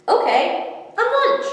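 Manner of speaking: high, loud cheerful squeak; naive fish. Acted. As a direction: agree happily without a clue. Fish gives in.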